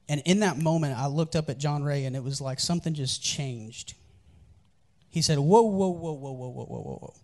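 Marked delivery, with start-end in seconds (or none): 5.4-7.1 a friend suddenly struck, then trailing off; retold startled whoa. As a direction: burst of surprise fading to nearly nothing.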